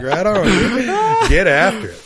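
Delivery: slow, high, laughing; laughing at the brother's grumpy answer; laughing reply. Retold or imitated. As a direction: say it while still cracking up. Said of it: "I started laughing".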